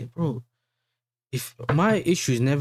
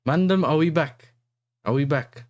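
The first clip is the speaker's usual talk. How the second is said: calling out to chat, raised and high; loud calling out; 8 semitones higher, 4 dB louder than usual. Real, not acted. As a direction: shout it to the chat, asking if they're back.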